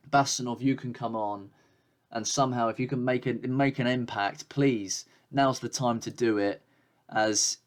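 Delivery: ordinary talk, nothing marked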